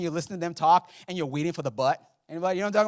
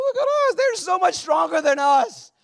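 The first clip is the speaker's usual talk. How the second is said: panicked spies; 14 semitones higher, 8 dB louder than usual. Retold or imitated.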